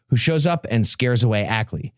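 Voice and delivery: ordinary talk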